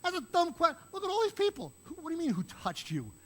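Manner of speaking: high, loud protest of disbelief; Peter, exasperated. Retold or imitated. Disciple pointing his Lord to all these people, exasperated. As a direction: blurt it out high, fed up with the question. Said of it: "That's what he's feeling like".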